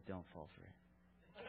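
very soft, slow, low mutter; barely audible note to self; hushed self-warning. Retold or imitated. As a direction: warn yourself under your breath, slowly. Father cautioning himself at night.

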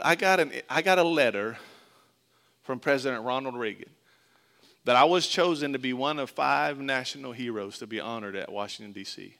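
ordinary talk, nothing marked

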